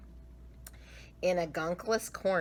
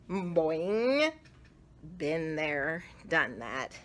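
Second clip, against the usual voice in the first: plain reading voice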